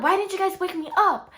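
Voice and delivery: high, loud mock complaint; imitating upset mom. Mom to daughters after waking from sleep paralysis, upset complaint.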